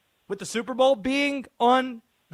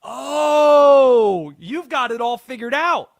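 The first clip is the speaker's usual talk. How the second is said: a long, loud, high cry of delight; sudden loud high whoop of amazement; big excited wail; 6 semitones higher, 13 dB louder, slower than usual. Real, not acted. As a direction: howl it out like you can't believe it. Host reacts to caller's clever pick.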